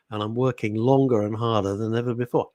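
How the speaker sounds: usual speaking voice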